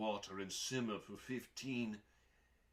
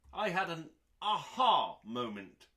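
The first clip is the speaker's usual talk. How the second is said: sudden burst of delight, louder; excited aha; 6 semitones higher, 8 dB louder than usual. Acted. Reading a recipe intro to listeners, an excited louder aha. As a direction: land the realization with a bright jolt.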